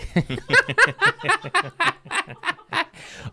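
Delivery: long, high-pitched cackle; high laugh. Real, not acted. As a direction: burst into a long squealing laugh. Laughs at his own dark joke.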